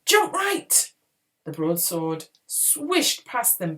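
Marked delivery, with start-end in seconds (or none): 0.1-0.9 high, loud urgent command; screaming. A narrator's reading. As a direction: scream the order in alarm. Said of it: "Podkin screamed".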